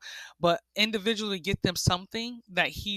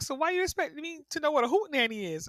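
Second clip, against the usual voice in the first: mock exasperation; 9 semitones higher, faster than usual. Retold husband's exasperated challenge.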